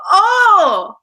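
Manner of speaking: sharp gasp, then a high, loud "oh"; swooning, drawn-out high "oh!"; awed gasp. Retold or imitated. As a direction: gasp and coo in wonder. Awed gasp over beautiful horses.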